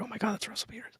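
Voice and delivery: high, very soft retold squeal; hushed fangirling. Fangirling outside on spotting Russell Peters, hushed high excitement. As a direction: act out starstruck excitement under your breath. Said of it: "I was totally fangirling".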